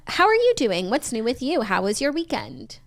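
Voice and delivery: normal talking voice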